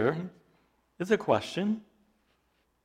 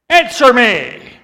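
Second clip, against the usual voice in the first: loud, slow, higher demand; emphatic command; 7 semitones higher, 14 dB louder, slower than usual. Retold or imitated. Jesus demands an answer from the elders, loudly. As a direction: slam the command home. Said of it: "it's very emphatic".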